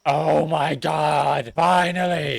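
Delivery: loud thrilled cry; excited shout. Real, not acted. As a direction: shout with delight. Finally seeing a big dinosaur.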